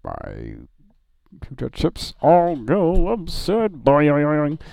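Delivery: lightly voiced booming robot; slow, loud, grand robot delivery; Patrick Stewart robot. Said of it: "do the voice of the robot".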